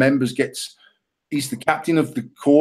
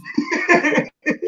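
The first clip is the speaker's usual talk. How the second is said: real high wheezy laugh; a high airy burst of laughter; high breathy laugh; 14 semitones higher than usual. Real, not acted. Laughs at his own shot.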